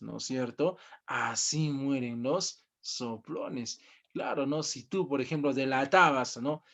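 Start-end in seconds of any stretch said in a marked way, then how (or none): 1.1-3.8 slow hushed reading. Retold or imitated. Teacher repeats the sign's words.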